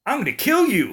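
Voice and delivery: high, loud wrestler growl on "Ooh, yeah!"; Macho Man Randy Savage. Retold or imitated. Pitching the killer's voice as a wrestling promo, hammed-up. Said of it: "gonna be like Macho Man, Randy Man Savage".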